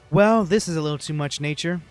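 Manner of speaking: normal talking voice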